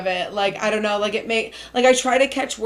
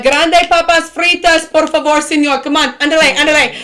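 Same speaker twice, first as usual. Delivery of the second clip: louder, mock-urgent call in Spanish; playful Spanish order-calling; 4 semitones higher, 5 dB louder than usual. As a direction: hurry the fry guy along in jest. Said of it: "talking to the fry guy".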